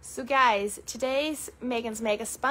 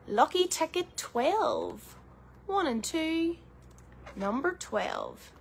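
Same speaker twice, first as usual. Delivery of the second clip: bingo caller; 4 semitones higher, slower than usual. Acted. Calling the ticket number.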